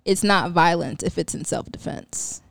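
slow, low, deliberate quote reading; quote recitation. Retold or imitated. Speaker reciting a favorite Malcolm X quote to friends, solemn recitation. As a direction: recite it slowly, with weight.

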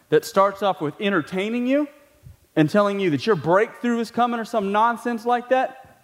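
ordinary delivery

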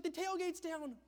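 wife's warning yell. Retold or imitated. Wife warning husband the tailgate is down, urgent yell. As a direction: call out the problem urgently. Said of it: "She's like".

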